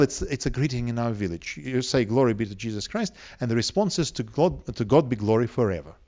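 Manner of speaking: normal talking voice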